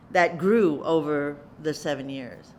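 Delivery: ordinary delivery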